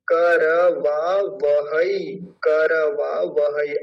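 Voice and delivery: करवावहै said slowly, syllable by syllable; slow syllable demo. Shown as a demonstration. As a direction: spell out करवावहै slowly, each syllable clear. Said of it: "अब इस शब्द को बड़े ध्यान से समझेंगे". (धीरे-धीरे अक्षर अलग करके)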